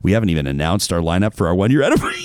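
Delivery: normal talking voice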